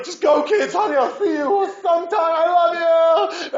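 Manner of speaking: high, loud shout calling after them; shouting ahead. Retold or imitated. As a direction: yell it across the terminal. Father shouting to running kids.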